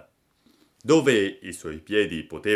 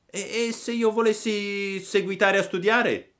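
high, questioning puppet voice; Pinocchio's puppet voice; 12 semitones higher than usual. Acted. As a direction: venture a question in a high little voice. Pinocchio challenges the boys. (vocina acuta di burattino)

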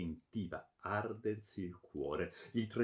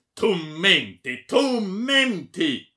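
loud, slow, stretched shouts; enraged roar; 9 semitones higher, 14 dB louder, much slower than usual. A narrator's reading. Baron throttling the friar, roaring in rage. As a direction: repeat it higher and longer in rage.

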